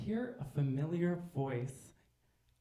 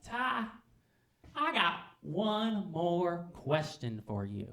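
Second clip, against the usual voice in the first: high, slow, drawn-out setup; Chevy; 4 semitones higher, much slower than usual. Acted. Chevy turns back to Ty before leaving, high and slow. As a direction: draw the question out slowly, high. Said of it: "he's like".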